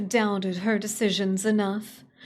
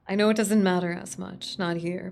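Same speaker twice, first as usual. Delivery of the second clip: usual speaking voice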